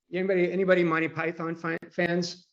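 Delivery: normal talking voice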